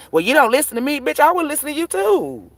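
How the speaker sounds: high, sneering mock retort; mocking man's comeback.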